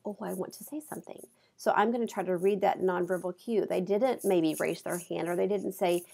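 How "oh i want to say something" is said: softer, quicker aside as a student; quiet imagined thought. Acted. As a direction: voice a student's unspoken wish under your breath. Imitating an eager, unspoken student.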